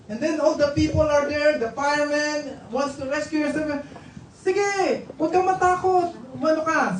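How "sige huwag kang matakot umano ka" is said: high-pitched acted calling out; calling up to child. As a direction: call up to the trapped boy from below. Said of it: "sumisigaw dun sa bata". (kunwaring pasigaw sa bata)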